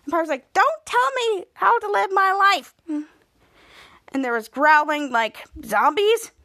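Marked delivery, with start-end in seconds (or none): 0.5-2.7 higher-pitched mock indignation; imitated sassy comeback; indignant retort. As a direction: talk back to a nagging brother. Piper snaps back at Henry.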